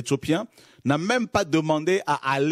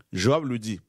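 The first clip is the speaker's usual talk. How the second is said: ordinary talk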